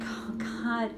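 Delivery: hint of panicky inner child; mock panic. Shown as a demonstration. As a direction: spiral into worry. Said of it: "that starts to panic about it".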